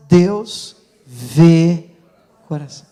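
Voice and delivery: emphatic call to repeat; leading a repeat. Shown as a demonstration. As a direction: lead the crowd through "Deus vê", pausing before "o coração". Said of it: "vamos repetir essa frase".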